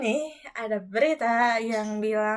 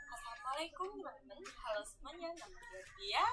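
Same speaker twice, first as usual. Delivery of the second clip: very quiet; 22 dB quieter than usual. Real, not acted. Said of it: "jelek banget suaranya".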